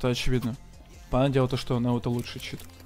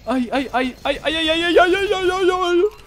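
high wailing ai ai; 6 dB louder than usual. Acted. Mock pained wailing in game.

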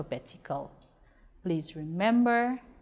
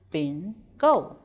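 "Bingo" split in two with a clear pause; syllable by syllable; 5 dB louder than usual. Shown as a demonstration. Teacher showing pupils two syllables in "Bingo", measured and separated. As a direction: break "Bingo" into two separate syllables, second one firm. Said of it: "two syllables".

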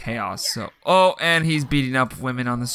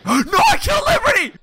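much higher loud dismay; shocked shout; 5 dB louder than usual. Real, not acted. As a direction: cry out in horror. Shocked at killing.

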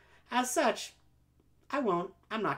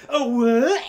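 mock surprise; 8 semitones higher, 12 dB louder than usual. Fake-trip acted surprise.

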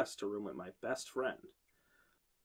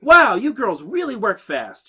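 much higher, louder, slow gushing; high, loud, slow and overly cheerful; bubbly RA voice; 9 semitones higher, 13 dB louder, slower than usual. A narrator's reading. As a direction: gush over them like a peppy dorm advisor. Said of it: "she said".

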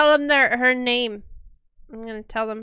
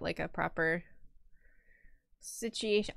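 usual speaking voice

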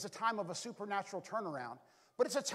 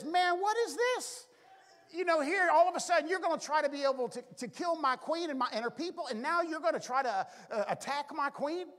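indignant, higher-pitched outburst; mock outrage, pitched up and pressing; enraged king; 6 semitones higher, 4 dB louder than usual. King confronts Haman over his queen, enraged. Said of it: "he's just enraged".

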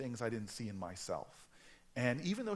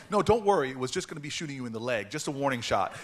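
loud retelling of Jace; 2 semitones higher, 9 dB louder, faster than usual. Retold or imitated. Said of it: "And he goes".

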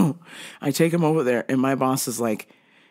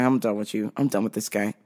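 lower, softer resigned aside; lowered fed-up grumble to yourself; fed-up mutter; 10 semitones lower, 6 dB quieter than usual. Retold or imitated. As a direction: say it under your breath, done with him. Fed-up aside about the customer.

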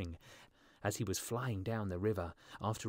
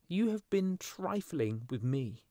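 high, slow, wounded swallow voice; indignant cry; 8 semitones higher, slower than usual. A narrator's reading. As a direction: accuse the beloved in a raised bird voice. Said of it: "he cried".

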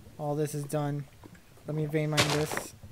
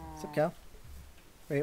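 normal talking voice

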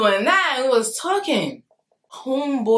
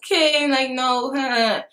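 higher, uneasy little brush-off; awkward laugh-off; 5 semitones higher, slower than usual. Retold or imitated. Girl laughing off his invitation, awkwardly. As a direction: imitate laughing off an uncomfortable moment. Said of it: "I just, like, laugh it off".

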